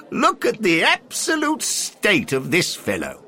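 loud, high, slow scornful exclamation; mocking old toff. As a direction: jeer at the poor man grandly. Henry mocks the referee.

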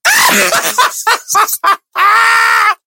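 a high, loud burst of real laughter; loud shrieking laugh. Real, not acted. Friend teases his accent, he shrieks with laughter.

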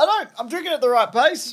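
high indignant protest. Real, not acted. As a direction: protest with mock offence. Indignant denial.

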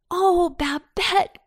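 high, slow wail of dismay; acted boyish lament; dismayed cry. A narrator's reading. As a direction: cry out to a little sister in dismay. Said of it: "cried he".

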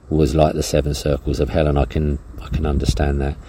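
normal talking voice

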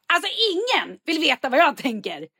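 exasperated retold outburst; fairly angry. Frustrated wife snaps during bathroom lamp planning, fairly angry. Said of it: "Ganska argt".

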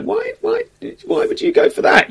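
a friend who can't believe it; skeptical friend. Retold or imitated. Guest imitating his sceptical friend, high doubting voice. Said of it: "slightly skeptical, like".

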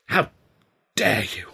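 halting, restrained outrage; mild, stunned indignation; indignant cry. A narrator's reading. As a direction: break it up with a pause before 'dare'. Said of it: "Ed cried, going red in the face".

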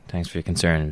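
usual speaking voice

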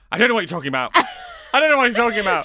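mother huffily denying everything; indignant denial. Retold or imitated. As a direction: protest too much.

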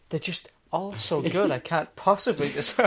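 exaggerated, indecisive fanboy gushing; mock gushing fan. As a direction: gush like a hopelessly smitten fan, mockingly. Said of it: "you're like".

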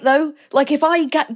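ordinary delivery

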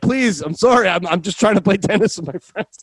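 high-pitched apologetic mock begging; pleading. Driver begging off a fine to play tennis, pleading.

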